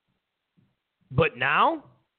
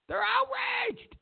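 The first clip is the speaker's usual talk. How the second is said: "outraged" said much higher and slower in sarcastic mockery; sarcastic high outrage; 4 dB quieter, slower than usual. Real, not acted. Host mocking the outraged Democrats, "outraged" sung out high and slow. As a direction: stretch "outraged" up high, mocking their shock. Repeats phrase for emphasis.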